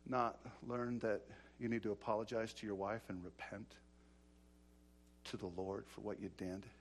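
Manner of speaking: very low and soft, measured reproof; quiet rebuke. Retold or imitated. To a brother who cheated, quiet reproof. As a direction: press the point in a hushed, low voice.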